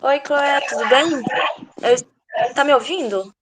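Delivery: loud, over her own headphones; talking loudly with headphones on; too loud, headphones on. Real, not acted. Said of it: "Porque eu tô com fone".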